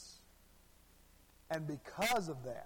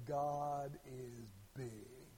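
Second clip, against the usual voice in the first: slow, low, drawn-out preacher proclamation; preacher's opening line; 5 semitones lower, 8 dB quieter, much slower than usual. Retold or imitated. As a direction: imitate a preacher starting low, stretching each word. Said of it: "he starts off".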